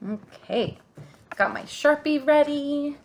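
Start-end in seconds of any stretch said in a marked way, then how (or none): none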